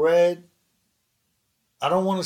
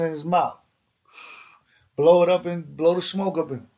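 normal talking voice

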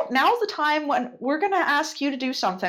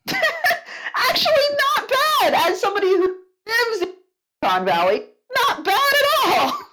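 laughing; 11 semitones higher than usual. Real, not acted. Laughing praise of accent.

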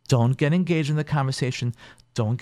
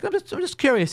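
mock innocent. Therapist feigning innocence.

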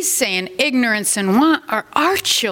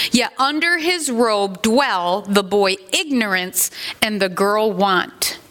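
usual speaking voice

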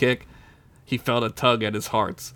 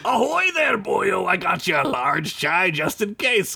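very high, squeaky pirate hail; cheery tiny pirate voice; clam pirate; 7 semitones higher than usual. A narrator's reading. Clarence brings Sid a chai, squeaky and cheery. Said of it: "Clarence said".

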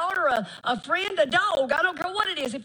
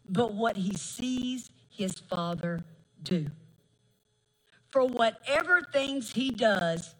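slow, solemn reading of the verse; scripture reading; 7 semitones lower, slower than usual. Acted. Preacher reading John to the congregation, slow and solemn. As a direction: let each word of scripture land slowly.

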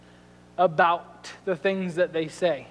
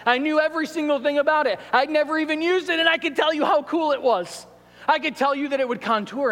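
excited; 7 semitones higher, faster than usual. Real, not acted. Gushing about the mug.

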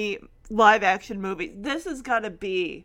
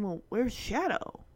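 low-key, softer thinking aloud; wondering; 3 semitones lower, 7 dB quieter than usual. Retold or imitated. Peter notices his dog is missing, quiet wondering. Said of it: "Peter's kinda, like, thinking".

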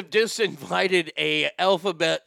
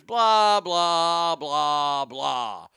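drawn-out blahs, stepping down in pitch; dismissive blah-blah; 2 semitones lower, much slower than usual. Real, not acted. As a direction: skip the rest with long, sinking blahs. Dismisses the rest of article.

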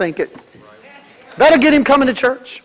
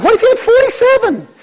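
much higher, disbelieving question; incredulous retort; 12 semitones higher than usual. Retold or imitated. Pastor answering mothers with one child, high incredulous retort. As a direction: toss it back in disbelief.

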